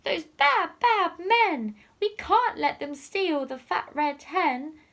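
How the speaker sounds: high, loud outraged cat voices; indignant cats. Acted. The cats protest the thieves' plan, high and loud. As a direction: cry it out like two cross cats. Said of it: "the two cats meowed".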